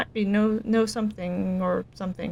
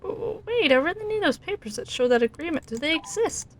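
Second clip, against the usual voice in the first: 1 high pleading; 6 semitones higher than usual. Acted. Oliver pleads with the clerk.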